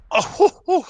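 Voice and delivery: sudden high, amazed hoot; surprised whoop. Real, not acted. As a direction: whoop like it caught you off guard. Caster reacts to nade spam.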